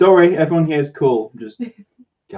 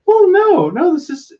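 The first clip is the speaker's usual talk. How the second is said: much higher, defensive no, no; high-pitched defensive reply; high protest; 3 dB louder than usual. Real, not acted. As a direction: jump up high to object. Protesting partner's teasing.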